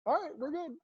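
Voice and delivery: high, soft imitation of a coach; casual sign-off in a light, high put-on voice; coach letting it go. Coach letting player off, retold.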